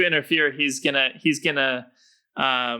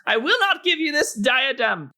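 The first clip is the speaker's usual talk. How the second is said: halfling rogue voice; 3 dB louder than usual. Acted. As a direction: pound the table and declare it in a high voice. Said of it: "pounds his little halfling fist on the table and says".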